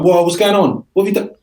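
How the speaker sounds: sudden shock at a bloody face; alarmed. Retold or imitated.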